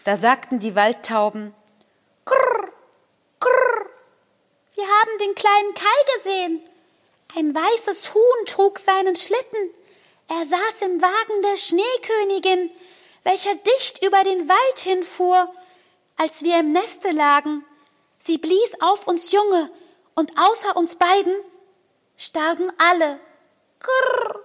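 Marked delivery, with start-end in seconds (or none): 2.3-3.9 very high rolled krrr; dove coo. Acted. Wood doves call down to Gerda with high krrr coos. 4.7-23.2 very high, light bird voice; wood dove voice. Acted. Wood doves tell Gerda of Kai in high bird voices.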